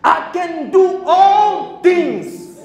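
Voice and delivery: loud, very slow, stressing all; proclaimed verse. Acted. Preacher quoting the verse in English to the church, loud and slow. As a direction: lay out each word like a proclamation.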